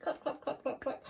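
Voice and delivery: soft whispered clap clap; whispered mock applause; whispered clapping. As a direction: breathe out the claps playfully. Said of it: "I want to clap for you".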